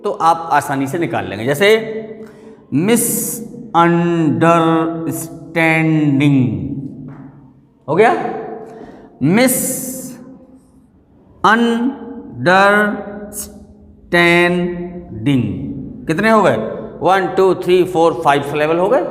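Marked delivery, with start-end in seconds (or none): 2.7-6.4 MISUNDERSTANDING stretched slowly, syllable by syllable; broken into pieces. Shown as a demonstration. Teacher showing how to find syllables, piece by piece. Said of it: "टुकड़ों में ही बोलेंगे". 9.2-15.4 MISUNDERSTANDING very slow, long gaps between syllables; broken into pieces. Shown as a demonstration. Teacher splitting the word for counting, piece by piece. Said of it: "टुकड़ों में ही बोलेंगे".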